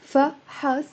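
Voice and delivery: fa... HAS for فحص; two syllables. Shown as a demonstration. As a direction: separate the syllables of فحص, both with "a". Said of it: "it becomes two syllables".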